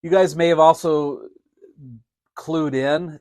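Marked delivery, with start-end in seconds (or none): none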